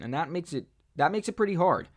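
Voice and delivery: usual speaking voice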